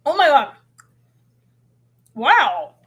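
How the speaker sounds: floored by the taste; amazed. Real, not acted. Tasting her keto cereal on camera, amazed exclaim.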